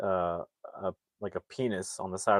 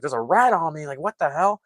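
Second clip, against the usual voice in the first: high, loud mock panic; freaking out; 7 semitones higher, 4 dB louder than usual. Local man spots a rat on his own shoulder, freaking out. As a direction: act out a man freaking out, pitch jumping up. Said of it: "he, like, freaks out".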